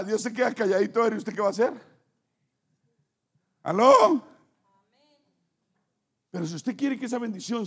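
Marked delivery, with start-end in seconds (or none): 3.6-4.2 high, loud "¿Aló?" as if on a bad line; calling out. Preacher calling out to a distracted listener, loud and high. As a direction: jolt the audience awake with a call.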